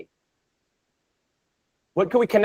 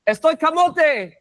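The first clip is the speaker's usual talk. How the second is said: raised pitch, mock-busy "Estoy camote"; imitating the cleaning lady; 6 semitones higher than usual. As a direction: toss it off like a busy cleaner walking away. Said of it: "she went".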